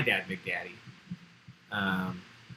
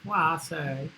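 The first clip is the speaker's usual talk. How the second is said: put-on Blanche accent; 3 semitones higher, 4 dB quieter, slower than usual. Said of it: "I can't do a Blanche accent".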